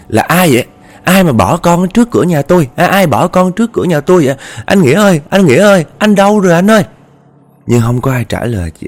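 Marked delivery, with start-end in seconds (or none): 0.1-6.8 high, loud frightened calls; calling loudly. Acted. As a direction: shout into the rainy night for help. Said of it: "Thì chị mới kêu lớn á".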